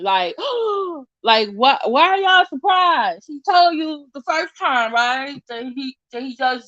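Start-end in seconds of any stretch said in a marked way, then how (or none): 0.4-1.0 sharp high intake of breath; mock shocked gasp. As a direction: imitate a crowd gasping in surprise. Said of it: "they're gasping".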